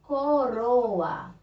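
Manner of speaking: "Coroa" stretched out with a light tapped R; teacher modelling "Coroa" with the weak, tapped R; tapped single R. Shown as a demonstration. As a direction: say "Coroa" carefully, flicking the tongue on the R. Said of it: "o R, ele é tremido".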